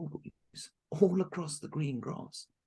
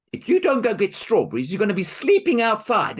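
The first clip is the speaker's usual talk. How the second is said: loud, higher threat; threatening stepmother; 7 semitones higher, 13 dB louder than usual. Acted. Stepmother threatening Marushka, loud and harsh. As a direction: threaten her sharply.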